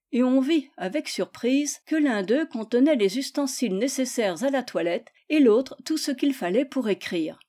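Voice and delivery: plain reading voice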